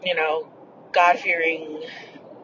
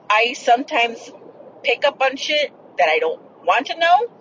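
ordinary delivery

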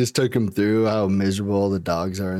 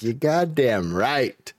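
slow, smug; much slower than usual. Retold or imitated. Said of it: "I was like".

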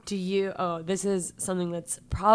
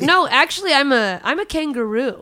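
mock claim; 5 semitones higher, 6 dB louder than usual. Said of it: "They're like".

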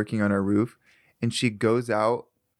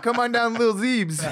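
high, laughing hype-man call; hammed-up ad voice; mock rapper ad; 10 semitones higher than usual. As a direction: announce it like a rap club promo. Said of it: "I was like".

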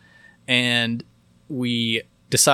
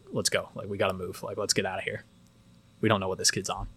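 hushed urgent; 3 semitones lower, 9 dB quieter than usual. Retold or imitated. Hushed urging to friend.

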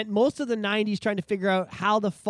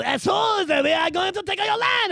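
high, loud comic taunting; mock French invader; 11 semitones higher, 6 dB louder than usual. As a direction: crow it like a cartoon invader. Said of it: "then the French are like".

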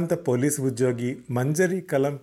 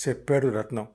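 ordinary delivery, nothing marked

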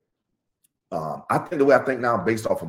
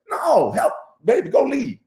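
mock pleading; 11 semitones higher, 3 dB louder than usual. Acted plea to wife, crying sound.

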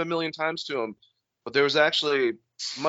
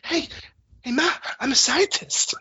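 Mark Wahlberg impression; 12 semitones higher than usual. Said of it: "Mark Wahlberg guy".

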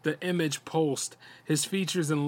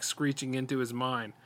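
ordinary delivery

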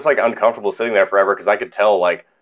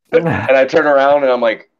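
ordinary talk

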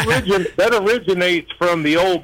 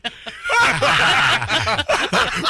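a high burst of laughter; 10 semitones higher than usual. Real, not acted. Guest cracking up at his own dance story.

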